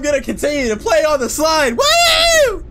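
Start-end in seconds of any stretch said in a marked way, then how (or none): 1.8-2.6 high, loud woo; excited whoop. Acted. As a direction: whoop it out with glee. Sliding down once more.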